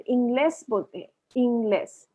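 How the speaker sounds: इंग्लेस with no H, ending in a clear es; Spanish pronunciation demo. Shown as a demonstration. Teacher shows learners how Spanish says इंग्लेस. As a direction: say इंग्लेस the Spanish way, ees-GLES. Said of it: "Spanish में इंग्लिश को इंग्लिश नहीं बोलते".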